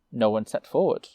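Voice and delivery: usual speaking voice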